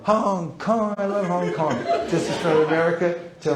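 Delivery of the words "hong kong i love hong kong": mock delight. Shown as a demonstration. Demo praising stranger's hometown.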